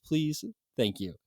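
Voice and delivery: normal talking voice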